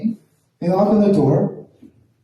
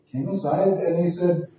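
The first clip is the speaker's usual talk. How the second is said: ordinary talk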